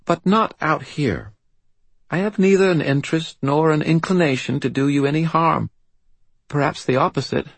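put-on high, formal little-girl voice, measured; self-possessed child archive. A narrator's reading. Child assures wizard she means no harm, composed and serious. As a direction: reassure him as a grave British child.

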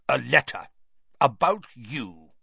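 lightly voiced accusing uncle, punched out; stern uncle. A narrator's reading. Uncle confronting Harry about a letter, lightly stern. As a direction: accuse the boy, slightly forceful.

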